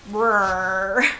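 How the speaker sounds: playful growl like a bear. Retold or imitated. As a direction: growl it out as a druid in bear form. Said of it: "Yes, I did growl at people".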